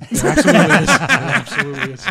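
loud, high burst of real laughter; laughing agreement. Real, not acted. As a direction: agree loudly while cracking up. Cracking up at joke.

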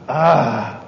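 low, mimicked shocked gasp; imitated gasp. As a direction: let out the man's stunned gasp. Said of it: "like that".

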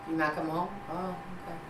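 quiet, offhand acceptance; nonchalant. Retold or imitated. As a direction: let it drop without caring. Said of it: "I'm real nonchalant".

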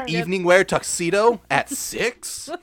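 high, loud, slow disbelief with laughter; mock outraged mother. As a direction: play a scandalised mom, amused underneath. Mock mother scolding child's outfit.

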